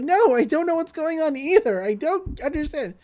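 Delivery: high, pleading mock protest; panicked denial. Boy being shaken, denying he knows anything, panicked.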